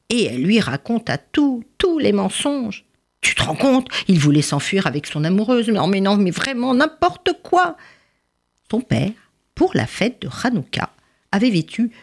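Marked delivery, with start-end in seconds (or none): 3.2-7.8 exasperated, pitch climbing high at the end; indignant mother. Acted. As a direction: complain in rising outrage to the father. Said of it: "quand même fâchée". (mère outrée, de plus en plus aiguë)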